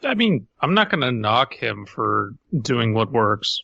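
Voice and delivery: ordinary talk, nothing marked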